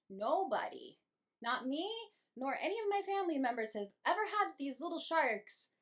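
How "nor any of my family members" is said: ordinary talk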